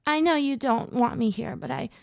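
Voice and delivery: high, voice cracking with emotion; tearful, breaking voice; cracked voice. Acted. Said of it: "Her cracked voice broke my heart".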